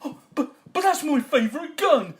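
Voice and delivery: high, loud wail of protest; wounded farmer. Acted. Farmer loses favourite gun, high wail. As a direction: whine over a broken treasure.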